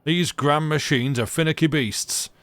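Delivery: a grumpy Yorkshire mechanic brushing off a question; thick Yorkshire accent. Acted. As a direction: grumble it in broad Yorkshire. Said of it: "in a thick Yorkshire accent".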